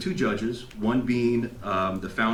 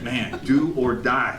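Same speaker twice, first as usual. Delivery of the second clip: slow resolve; much slower than usual. Retold or imitated. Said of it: "And I was like".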